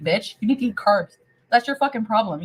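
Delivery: ordinary talk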